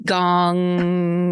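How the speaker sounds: long, sustained gong on one note; clock gong imitation. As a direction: draw out a resonant "gonnng". Imitates grandfather clock striking.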